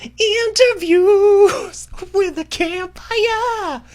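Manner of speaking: very high, drawn-out title call; announcer voice. Acted. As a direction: sing out the title slow and high. Dramatic segment title.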